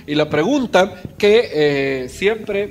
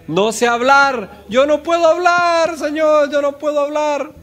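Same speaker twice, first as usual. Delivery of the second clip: Moses' excuse; 7 semitones higher than usual. Retold or imitated. Moses pleading with God that he can't speak, high. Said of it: "cuarta excusa de Moisés".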